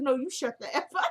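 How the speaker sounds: giggling through the quote; laughing. Real, not acted. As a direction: crack up while repeating it.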